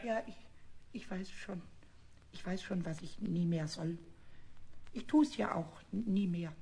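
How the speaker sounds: small sheepish voice; meek contrition. Acted. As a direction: mumble it meekly.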